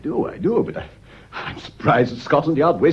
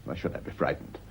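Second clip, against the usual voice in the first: hushed denial; 10 semitones lower, 15 dB quieter than usual. Acted. Husband denies fear, hushed.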